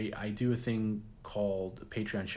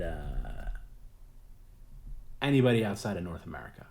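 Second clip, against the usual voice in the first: ordinary talk, nothing marked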